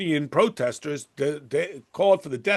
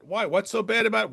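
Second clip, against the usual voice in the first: ordinary delivery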